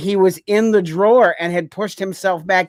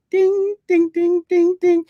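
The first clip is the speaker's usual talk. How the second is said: put-on higher sung ding sounds like a kid's keyboard; toy piano dings; 12 semitones higher, slower than usual. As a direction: plink out a toy keyboard with your voice. Mimicking toy piano playing.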